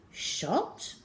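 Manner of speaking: ordinary delivery, nothing marked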